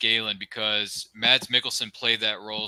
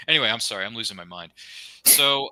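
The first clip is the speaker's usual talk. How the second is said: ordinary delivery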